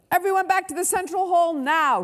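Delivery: high, loud command; shouted order. A narrator's reading. Shouting everyone back to the hall, urgent order. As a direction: call the whole group back urgently. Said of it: "shouted Ernest Ella".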